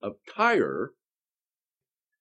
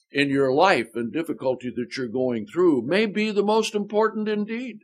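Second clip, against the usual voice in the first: ordinary delivery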